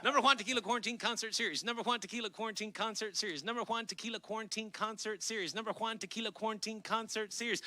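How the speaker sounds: quick, singsong repetition without a break; rapid tongue twister. Shown as a demonstration. Host saying the series name five times fast on a dare, rapid-fire. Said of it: "Say it five times fast".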